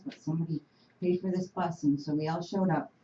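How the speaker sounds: ordinary delivery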